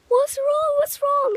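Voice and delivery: very high, breathy, loud mock alarm; imitating alarmed friend. Voicing alarmed friend on phone.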